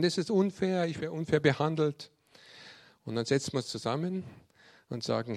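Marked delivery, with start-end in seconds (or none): 0.0-2.1 higher, louder imitation of a sore loser; mock whining child. Young scout who keeps losing games complains, mock whining. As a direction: protest like a child losing a game. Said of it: "dann fangen sie zum Heulen an".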